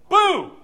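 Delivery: loud high shouted boom like a door slamming open. Retold or imitated. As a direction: bark out the door's boom. Door bursting open in story.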